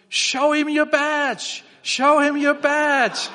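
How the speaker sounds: high, loud, slow shout; yelled really loud. Retold or imitated. Farmer shouting to officer chased by bull, loud slow yell. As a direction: bellow it across the field. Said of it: "he yelled really loud".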